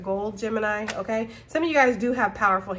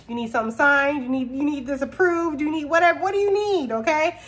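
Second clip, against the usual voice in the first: building higher and louder, clipped questions to the coworker; impatient demands; 5 semitones higher, 4 dB louder than usual. Retold or imitated. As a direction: fire off what do you need, getting more exasperated. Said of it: "you're gonna be like".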